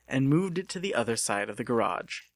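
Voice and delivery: ordinary delivery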